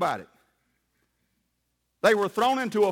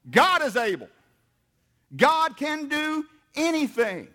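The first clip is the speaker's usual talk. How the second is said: proclaimed; 5 semitones higher than usual. Real, not acted. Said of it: "Shout it out".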